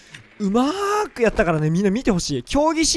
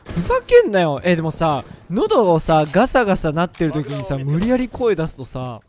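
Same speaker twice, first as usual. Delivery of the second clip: usual speaking voice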